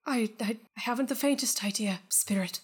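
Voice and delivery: trailing into a whisper on spirit; timid miser. Acted. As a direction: answer meekly, fading out.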